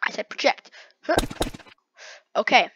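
ordinary delivery

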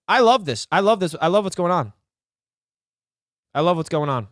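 ordinary talk

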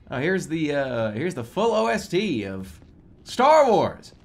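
ordinary talk, nothing marked

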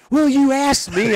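high, loud, slow retort through a laugh; laughing mock protest; laughing retold retort. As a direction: throw it back while cracking up.

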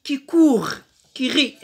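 high, loud declaimed verse; poem recitation. Shown as a demonstration. Teacher reads the poem to pupils, loud declaimed recitation. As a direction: declaim each short phrase high and loud. Reading poem aloud in lesson.

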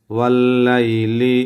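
ওয়াল্লাইলি ইযা সাজা drawn out slowly; slow recitation. Shown as a demonstration. Teacher recites the verse slowly for students. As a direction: chant it slowly, word by word. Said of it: "দেখছেন?".

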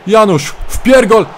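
excited commentator yell. Acted. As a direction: shout the name as he breaks away. Shouting player name on breakaway.

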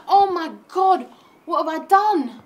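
hammed-up horror at what he did; mock horrified. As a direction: gasp at your own mistake. Horror at killing.

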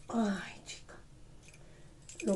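breathy weary whisper; whispered sigh. Real, not acted. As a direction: sigh it under your breath. Tired sigh to herself.

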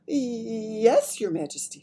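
ordinary delivery

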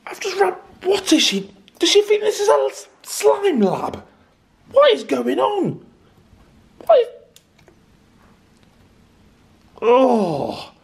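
high loud exasperated rant; outraged shouting. Real, not acted. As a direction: shout in disbelief at sister. Outraged at slime lab.

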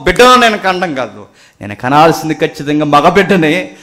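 high, loud impassioned sermon; full-force preaching. Acted. Preacher urging the church over Hannah's decision, full-force preaching. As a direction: drive each point home at full volume.

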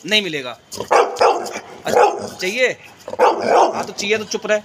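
high, loud sing-song teasing of the dogs; teasing pet talk. Real, not acted. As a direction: dangle the treat with a squeaky high voice. Teasing pets with a biscuit.

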